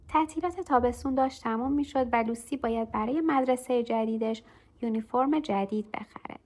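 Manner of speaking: plain reading voice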